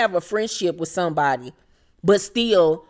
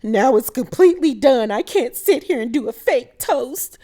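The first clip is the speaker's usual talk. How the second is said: higher-pitched imitation of an upset protest; Kyle's mock upset; 5 semitones higher than usual. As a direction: protest in a hammed-up, raised voice. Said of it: "And here go Kyle".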